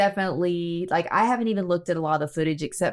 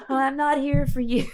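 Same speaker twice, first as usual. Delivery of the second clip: mock nun; 6 semitones higher than usual. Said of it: "I'll be like".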